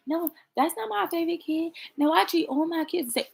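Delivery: put-on high parent voice; imitated parent. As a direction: protest like a parent defending themselves. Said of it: "Every parent's like".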